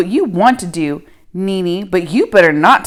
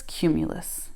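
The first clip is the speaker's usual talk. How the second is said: "cumulus" dropped low and much softer; fading off; 5 semitones lower, 14 dB quieter than usual. Acted. Girl finishing her cloud chant, trailing off softly. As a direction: let "cumulus" trail away to almost nothing.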